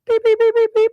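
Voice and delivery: high, loud beep-beep like a machine alarm; imitated alarm beeps. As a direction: mimic the basement alarm going off. Imitating the basement water alarm.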